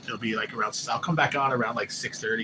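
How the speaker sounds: ordinary talk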